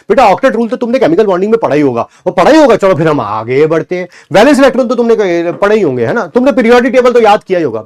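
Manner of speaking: loud, fast mock teacher assuming students know it; mocking other teachers. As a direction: lampoon a careless teacher's breezy tone. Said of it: "मैं ऐसा कोई भी शब्द का उच्चारण नहीं कर रहा हूं".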